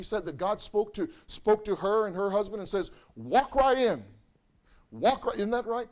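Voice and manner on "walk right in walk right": higher, louder call to walk in; ringing prophetic proclamation; proclaiming. Retold or imitated. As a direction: announce God's invitation boldly. Quoting God's word to her.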